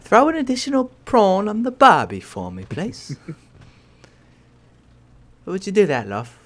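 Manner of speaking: hammed-up Australian accent. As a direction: put on a cartoon Australian accent. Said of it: "You should do an American accent".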